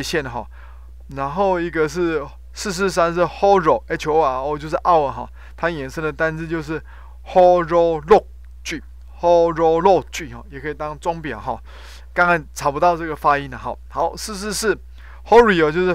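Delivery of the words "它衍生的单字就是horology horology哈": normal talking voice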